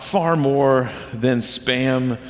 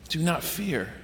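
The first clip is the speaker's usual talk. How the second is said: "Do not fear" said much softer, settling down; soft reflective; 9 dB quieter than usual. Shown as a demonstration. Pastor quietly sums up the command, soft and reflective. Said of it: "quiet restatement".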